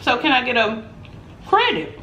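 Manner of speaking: high, bright customer voice on the phone; butter up the agent; sweet phone voice. Retold or imitated. Calling the cruise lady about a price drop, high sweet phone voice.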